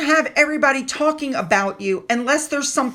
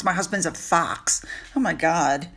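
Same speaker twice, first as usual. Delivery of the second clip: low, quieter mocking echo; mocking disbelief; 7 semitones lower, 5 dB quieter than usual. Commentator ridicules her calling her husband a fox, mocking disbelief. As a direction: drop low and soft, appalled at the wording.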